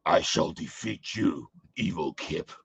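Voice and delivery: low, slow villain threat; Shredder voice. Pretend. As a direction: growl it like a cartoon supervillain. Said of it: "the Shredder voice".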